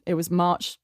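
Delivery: ordinary talk, nothing marked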